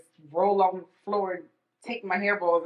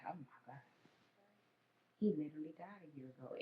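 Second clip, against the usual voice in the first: hushed realization; 18 dB quieter, faster than usual. Retold or imitated. Retold shocked realization.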